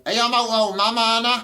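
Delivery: high mocking local girl voice; mock daughter. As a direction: ham up a daughter out with her mum.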